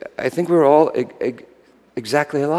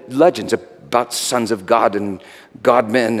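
ordinary talk, nothing marked

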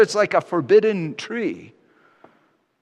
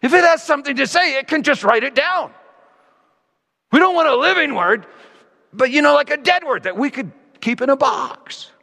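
higher, hurried voice of the frightened crowd; imagined fearful people; 9 semitones higher than usual. Retold or imitated. Preacher voicing the people who dread God's voice, higher and hurried. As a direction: voice the crowd wanting the word kept dead. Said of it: "It's as if they're saying".

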